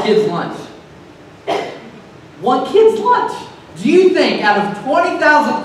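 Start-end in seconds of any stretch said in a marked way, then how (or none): 2.4-3.3 high, disbelieving question; incredulous. Acted. Preacher doubting the story to his congregation, incredulous. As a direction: repeat it in squeaky disbelief, much higher.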